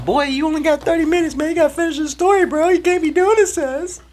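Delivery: high, fast squeaky voice mimicking viewers; impatient audience. Viewers nagging him to finish the story, squeaky mock voice. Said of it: "They're like".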